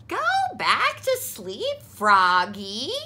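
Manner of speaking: a hint of a firm mother; mother telling Froggy to sleep; mother's voice. A narrator's reading. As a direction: send him back to bed. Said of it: "said his mother".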